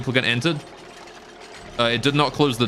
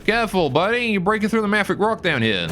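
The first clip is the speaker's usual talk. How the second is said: high, raised warning call; sharp playground warning; 9 semitones higher than usual. Acted. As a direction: warn him off, sharp and high. Warning a duplicant on screen.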